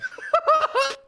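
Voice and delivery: squeaky high laugh; high-pitched laugh. Real, not acted. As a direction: burst out in a thin high laugh. Laugh after the tweet.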